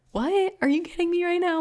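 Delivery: high-pitched incredulous reenactment; astonished, can't-believe-it retelling; stunned disbelief. Retold or imitated. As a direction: replay the shock, voice shooting way up. Retelling stunned reaction to book passage.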